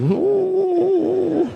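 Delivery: high, whining ooh; mock sad dog moan; pitiful dog whimper. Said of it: "This is".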